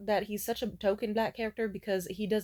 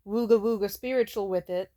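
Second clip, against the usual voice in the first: ordinary talk, nothing marked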